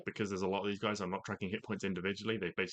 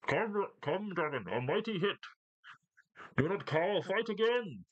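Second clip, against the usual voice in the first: high muffled voice from behind cover; 13 semitones higher, 4 dB louder, slower than usual. Acted. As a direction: call out high, as if through a wall. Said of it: "muffled voice".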